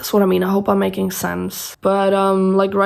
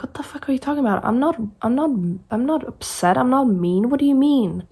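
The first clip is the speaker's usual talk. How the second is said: higher-pitched, indignant denial; upset protest; 5 semitones higher than usual. Retold or imitated. Answering people who call her tone rude, upset. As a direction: protest in baffled, offended disbelief. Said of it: "then I sound even more upset".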